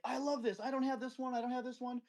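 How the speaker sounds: a collector finding treasures; thrilled. Retold or imitated. Friend digging through cuttings in the trunk, gushing high and thrilled.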